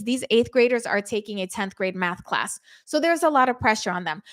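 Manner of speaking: ordinary delivery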